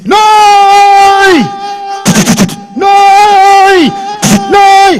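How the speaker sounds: high, long drawn-out shouted no; filmy death cry. Retold or imitated. Mithun under machine-gun fire, long high shouted refusal. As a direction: scream it like a hero who won't die.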